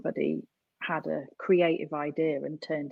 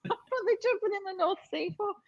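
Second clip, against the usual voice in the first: incredulous; 11 semitones higher, 4 dB louder, faster than usual. Retold or imitated. Recalls amazement, laughing at the dips.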